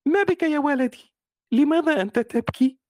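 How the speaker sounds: high, loud, worried mother asking; caring mother voice. Shown as a demonstration. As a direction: call to your little one with concern. Mother asks her crying son.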